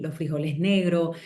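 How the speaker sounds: normal talking voice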